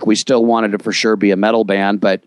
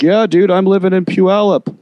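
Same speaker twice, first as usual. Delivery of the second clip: bro voice, pitched up; imitating friend; 9 semitones higher than usual. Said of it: "And he's like".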